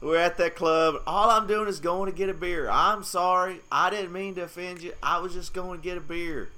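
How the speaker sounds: lightly voiced apologetic friend; imitating Liff's apology. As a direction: play the sheepish guy saying sorry at the club. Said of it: "make an apology in that kind of situation".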